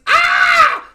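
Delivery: high, loud shrieking ah; a sudden silly shriek; scream. Retold or imitated. Kids' recorded scream on the Waze, piercing shriek. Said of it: "my kids come on".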